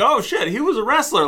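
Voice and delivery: high, startled aha; light up in sudden surprise; surprised realization. Retold or imitated. Retold realization.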